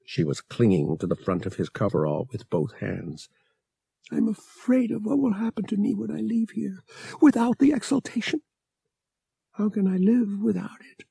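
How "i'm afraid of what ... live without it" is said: a high, pleading voice full of fear; frightened young woman. A narrator's reading. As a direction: Bria clinging to Han, scared to leave. Bria's frightened voice, read by narrator.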